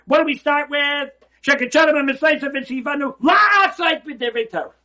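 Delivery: loud, high preaching at full force; rising sermon fervour; fiery preaching. Acted. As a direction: drive it home to the congregation. Preacher proclaims the blessing.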